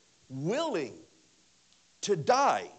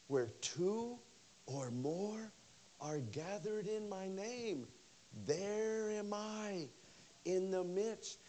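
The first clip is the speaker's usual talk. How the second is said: soft reverent quote; 3 semitones higher, 8 dB quieter, slower than usual. Retold or imitated. Quoting Jesus.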